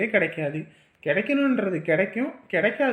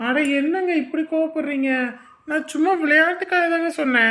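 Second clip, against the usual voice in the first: startled pleading; 7 semitones higher than usual. Acted. Man defends himself when scolded.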